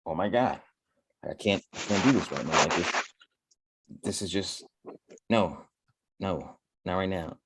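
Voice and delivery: low, soft, overwhelmed muttering; drained inner voice while losing a trade; exasperated mutter. Retold or imitated.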